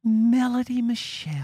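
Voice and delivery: high imitating voice; [name] impression. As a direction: echo her reading of the name. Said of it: "Then I'm gonna have to impersonate [name]".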